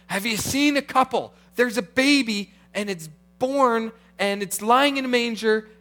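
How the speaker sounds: high, loud and breathless with news; excited shepherds. Retold or imitated. Shepherds asking strangers about the baby, excited and high. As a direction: ask around town, thrilled and eager. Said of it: "they're telling everyone".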